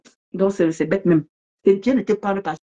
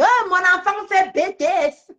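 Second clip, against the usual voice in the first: mocking imitation; 9 semitones higher, slower than usual. Mimics rival bragging on live.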